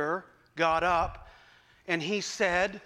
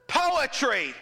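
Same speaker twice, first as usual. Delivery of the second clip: calling out; 7 semitones higher, 4 dB louder than usual. Retold or imitated. Congregant calls out choice.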